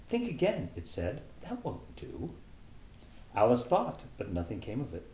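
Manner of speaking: animated storyteller reading Alice in Wonderland; story narration. A narrator's reading. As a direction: read it like a bedtime storybook. Reads Alice aloud.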